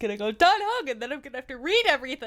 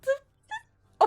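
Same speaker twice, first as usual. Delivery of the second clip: higher, louder stunned stammer; surprised realization; 11 semitones higher, 4 dB louder than usual. Real, not acted. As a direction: it just clicked, blurt it out. Realising a name, voice jumps.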